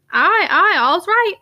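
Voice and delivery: bluff, cheerful dialect answer, lightly voiced; gruff Yorkshire visitor. Constable Sugden reassures Moore, lightly voiced Yorkshire.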